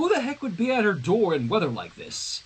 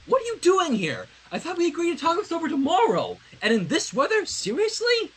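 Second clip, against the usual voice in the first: higher, fast, stunned and incredulous; shocked disbelief; 10 semitones higher than usual. Acted. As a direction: gape at her in disbelief. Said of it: "Sunset said, her mouth hanging open".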